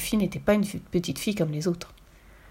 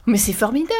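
higher, louder burst of glee; delighted; 4 semitones higher, 5 dB louder than usual. A narrator's reading. Girl thrilled the burglars can dance, delighted. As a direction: exclaim with childlike delight. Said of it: "dit Fifi en battant des mains".

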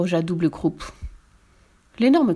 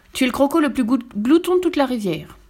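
high-pitched storybook scolding; indignant little crocodile; scornful insult; 7 semitones higher, 3 dB louder than usual. A narrator's reading. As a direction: hurl the accusation with a raised pitch. Smaller crocodile insults the big one.

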